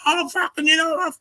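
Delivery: high, strained mock yell of a chest-beater; mocking a tough guy's empty yelling threat; mock raging threat. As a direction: ham up a scared guy raging to scare someone. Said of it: "trying to scare you and rage".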